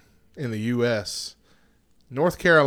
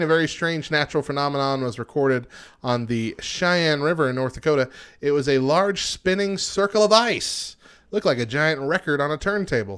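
ordinary talk, nothing marked

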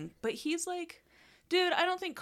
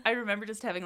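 ordinary delivery

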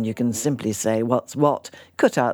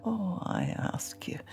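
whispering; 6 semitones higher, 11 dB quieter than usual. Acted. Mother scoffs at bingo.

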